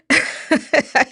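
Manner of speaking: loud, high burst of laughter; real amused cackle of disbelief; scornful laugh. Real, not acted. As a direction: laugh out loud at a ridiculous statement. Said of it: "gotta laugh at that statement".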